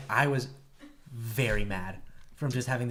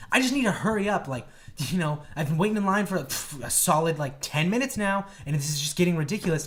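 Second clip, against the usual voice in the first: raised, louder complaint; pushy, higher-pitched griping; impatient customer; 5 semitones higher, 4 dB louder than usual. Retold or imitated. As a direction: imitate an exasperated woman in line.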